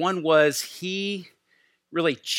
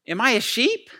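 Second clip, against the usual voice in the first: "Am I a sheep?" asked much higher and louder, rising, anxious; troubled question; 10 semitones higher, 4 dB louder than usual. Retold or imitated. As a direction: ask it as a worried old man pleading. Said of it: "it sounds like he was troubled".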